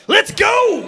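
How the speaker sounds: rally the gang into the charge; battle cry. Acted. Outlaw leader urging his gang forward, shouting.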